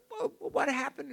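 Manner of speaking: high, slow, tearful question; pleading, near tears. Retold or imitated. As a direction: voice cracking with tears. Retold plea, crying heard.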